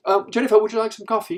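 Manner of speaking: high, loud, pointed offer; barely controlled jealousy. Acted. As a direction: play the wife failing to hide her annoyance. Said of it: "can't control it".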